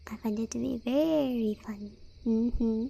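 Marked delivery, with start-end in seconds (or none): none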